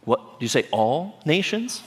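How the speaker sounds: double-check in mock disbelief, pitch climbing; incredulous question. Disciples balk at the huge task.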